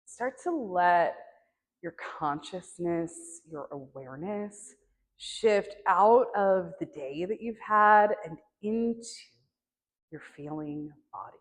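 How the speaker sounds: unhurried meditation guide, long silences between phrases; guided meditation. Acted.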